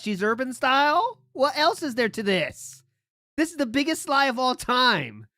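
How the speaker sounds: loud, disbelieving outburst; incredulous shouting. Real, not acted. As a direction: yell in disbelief at the story.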